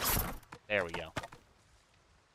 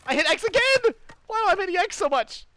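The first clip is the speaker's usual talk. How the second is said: exasperated shout; 5 dB louder than usual. Real, not acted. Keeps hitting wrong button.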